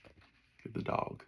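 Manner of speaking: breathy whispered aside. Real, not acted.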